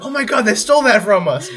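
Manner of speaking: retold outrage. Hearing their song in an ad, mock indignant exclaim. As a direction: exclaim it like you've been robbed. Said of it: "I'm like".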